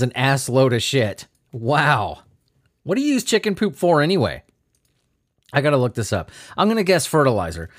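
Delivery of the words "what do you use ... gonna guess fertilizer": ordinary talk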